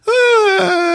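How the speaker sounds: put-on high, wordless kid's bawl; mock crying wail. Randy sent off to school, mock crying. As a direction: wail like a little kid bursting into tears. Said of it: "starts fucking crying".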